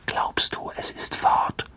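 very soft, voiceless whisper. A narrator's reading. Ron to Harry, wondering if it left, whispered. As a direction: whisper it cautiously. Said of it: "flüsterte Ron".

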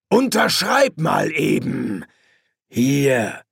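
slow order, then "Hier" set apart; drawn-out villain's command, "Hier" dropped in alone after a gap; ominous pause. A narrator's reading. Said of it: "trennte die beiden letzten Worte mit einer unheilvollen Pause".

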